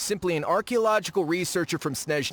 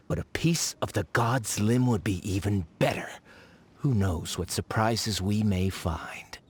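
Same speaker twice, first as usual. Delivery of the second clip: sinister whisper; 6 semitones lower than usual. Acted. Scheming researcher muttering aside.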